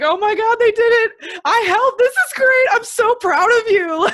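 high, loud, thrilled squeal; proud squeal of joy; excited gushing. Retold or imitated. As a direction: gush with delighted pride. Gushing quote of own pride.

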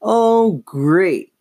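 high, loud exasperated complaint; muttered annoyance. A narrator's reading. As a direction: groan it out high, fed up. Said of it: "Chris muttered".

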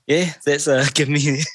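ordinary talk

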